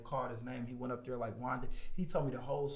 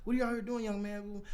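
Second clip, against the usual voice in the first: put-on energetic friendly old man; 8 semitones higher than usual. Homeowner greeting a young door-to-door salesman, energetic and friendly. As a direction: imitate a chipper, nice old homeowner, pitched way up. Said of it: "energetic, like, nice as hell".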